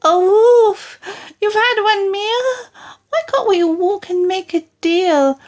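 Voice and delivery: high squealing plea; pleading pig. Acted. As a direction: beg the wolf for mercy. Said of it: "He cried".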